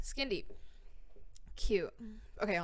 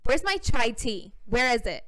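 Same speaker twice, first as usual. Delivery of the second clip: diva demand; 2 semitones higher, 7 dB louder than usual. Acted. Diva demands her chai tea.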